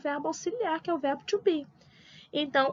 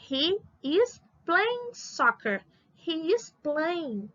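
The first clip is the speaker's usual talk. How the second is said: slow, drawn-out example sentence for learners; slow modelling; 3 semitones higher, much slower than usual. Shown as a demonstration. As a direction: stretch each English word out for the class. Teacher models example sentence slowly. (modelo lento, palavras esticadas)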